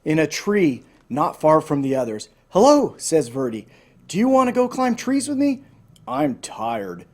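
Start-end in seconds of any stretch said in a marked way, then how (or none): 2.5-2.9 excited hello. Acted. Verdi calls up cheerfully. 4.1-5.6 high, bright-eyed eager question; young snake pleading to play, much higher; eager invitation. Acted. 6.1-7.1 lower, sleepy grumbling growl; a drowsy snake growling awake; mild sleepy growl. Acted. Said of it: "Dozer growled".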